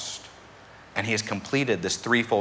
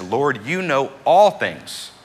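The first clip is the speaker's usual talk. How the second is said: slow, higher, louder hurt plea; exasperated and hurt; 5 semitones higher, 6 dB louder, slower than usual. Acted. Peter answers Jesus the third time, exasperated and hurt. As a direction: plead it out slowly, exasperated. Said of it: "he's exasperated and he's hurt".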